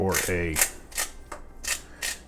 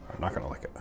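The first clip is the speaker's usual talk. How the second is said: whisper; 5 semitones lower, 7 dB quieter, faster than usual. Shown as a demonstration. Cook whispering to herself over the pot. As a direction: fade to a breathy whisper. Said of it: "repeats line, fading to whisper".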